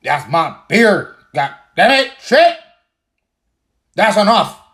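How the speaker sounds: loud, high, hammed-up beer-fan fury; mock tantrum about a beer brand; mock outrage. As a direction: play the outraged drinker over the top.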